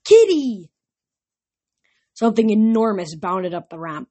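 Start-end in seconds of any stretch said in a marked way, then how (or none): 0.0-0.7 put-on high, loud child's squeal; delighted screech. A narrator's reading. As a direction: screech it like a thrilled little kid. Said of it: "screeched through the light".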